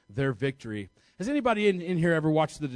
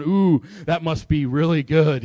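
mock admiring coo; 9 dB louder than usual. Couch potato admiring athletes on TV.

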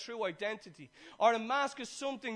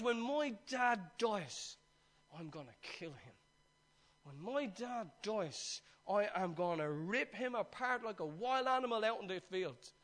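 bitter threat; 3 semitones lower, 6 dB quieter than usual. Acted. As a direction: draw out a quiet vow of revenge. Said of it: "Bitterness set in".

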